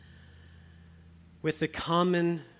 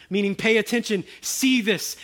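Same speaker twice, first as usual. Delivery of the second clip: louder, higher proclaiming climax; fervent preaching; 6 semitones higher, 8 dB louder than usual. Acted. Preacher announcing the King to the church, loud fervent proclaiming. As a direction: proclaim it like a herald.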